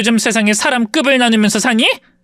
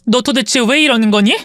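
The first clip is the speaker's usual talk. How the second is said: ordinary talk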